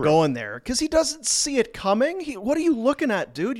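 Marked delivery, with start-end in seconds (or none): 0.6-2.2 a light mock of a clueless brawler, higher; mock dazed fighter. Mocking the dazed fighter on video. 2.2-3.6 lightly voiced confused brawler, a bit higher; mock dazed fighter. Voicing the fighter on video.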